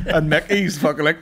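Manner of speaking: ordinary talk, nothing marked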